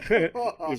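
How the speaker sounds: short high, loud laugh; amused burst of laughter. Real, not acted. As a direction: laugh out loud mid-story. Amused by own story.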